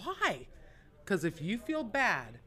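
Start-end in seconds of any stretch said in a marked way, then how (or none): 0.0-0.5 high-pitched, drawn-out questioning; retold bafflement. Rookie asking dealers about quitting, retold bafflement. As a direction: ask it high, as a wounded innocent.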